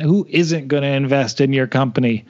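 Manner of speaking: ordinary delivery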